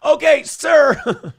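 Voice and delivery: high, loud laughing protest. Real, not acted. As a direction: break up laughing as you object. Cracking up at the clip.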